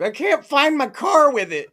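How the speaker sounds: high, loud mock whine; hammed-up helpless complaint; complaining customer. Customer whining to Apple store worker, mock complaint. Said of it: "He's like".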